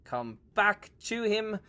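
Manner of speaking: usual speaking voice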